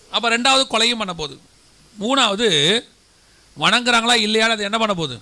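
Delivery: normal talking voice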